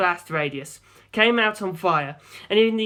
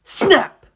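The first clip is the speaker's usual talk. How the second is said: snap; 11 semitones higher, 8 dB louder than usual. A narrator's reading. Camera shutter sound.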